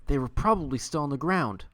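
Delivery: ordinary talk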